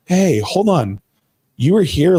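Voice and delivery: a second self snapping him out of panic; inner voice. Retold or imitated. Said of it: "my voice going".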